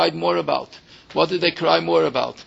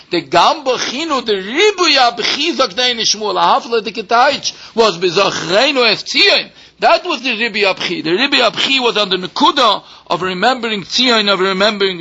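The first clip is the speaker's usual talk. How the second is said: preaching climax; 5 semitones higher, 3 dB louder than usual. Acted. Preacher revealing the answer, emphatic and raised. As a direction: drive the point home from the pulpit.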